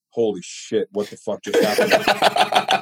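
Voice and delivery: stunned whisper-laugh, slow; laughing disbelief. Retold or imitated. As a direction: gasp it through laughter.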